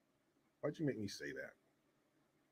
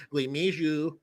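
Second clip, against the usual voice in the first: usual speaking voice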